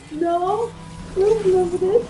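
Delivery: high, loud protest; retold panicked denial. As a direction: deny it in rising alarm.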